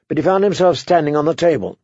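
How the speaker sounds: usual speaking voice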